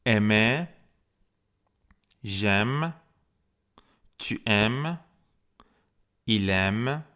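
French conjugation drill, each form set apart; teacher's drill with lilting rises and long gaps; repeat-after-me model. Shown as a demonstration. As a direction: model each form clearly for learners to echo. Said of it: "بعد من تکرار بکنین".

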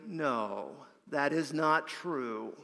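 low, slow, weighty denial; retold firm no, soft and deliberate; emphatic denial. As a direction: put it down quietly, word by word. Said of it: "he responded emphatically".

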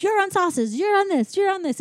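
high-pitched mock kitchen orders; bossy head chef. Remy telling everybody what to do, mock bossy orders. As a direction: hand out stations briskly, playfully commanding. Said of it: "telling everybody what to do".

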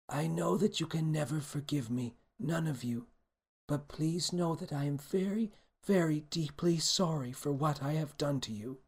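a touch of tearful remorse, as a queen begging pardon; tearful regret. A narrator's reading. Queen apologising to those she wronged, mildly tearful regret. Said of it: "her face stained with tears of regret".

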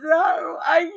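high-pitched imitation of tearful sputtering; too upset to get words out, hammed up; mock crying. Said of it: "sitting here crying".

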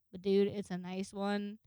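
usual speaking voice

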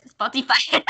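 mock the weird way she said Spotify; high, drawn-out teasing echo of "Spotify"; mimicked odd pronunciation. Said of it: "She said it really weird".

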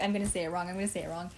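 low, soft self-doubt; retold anxious murmur; insecure muttering. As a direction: repeat it under your breath, unsure. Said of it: "I get so insecure".

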